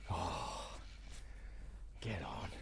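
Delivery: breathless whisper willing the fish to bite; whispered urging. Real, not acted. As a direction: hush it out to the fish. Urging fish onto the hook.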